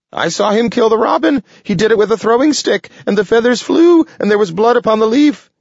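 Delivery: high, fast squeaky critter chatter; excited squirrel voice. Acted. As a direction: rattle it off like a frantic squirrel. Excited squirrel tells Jenkins.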